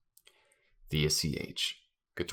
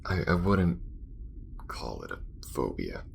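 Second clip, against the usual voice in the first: awkward, halting admission of nerves; embarrassed thanks turning to mock threat; guarded space crewmate; 5 semitones lower than usual. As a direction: own up reluctantly, with long hesitations. Acted character admits space anxiety.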